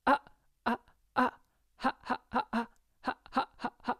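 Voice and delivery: short ha, ha, ha, quickening steadily; teacher drills glottal onsets, speeding up each round. Shown as a demonstration. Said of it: "So you're going to do ha".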